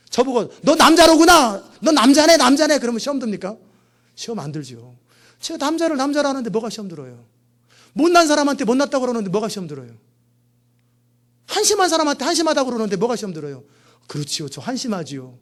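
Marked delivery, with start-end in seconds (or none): 0.6-2.8 mock accuser. Mocking insult voiced in sermon. 14.1-15.4 humble reply. Retold or imitated.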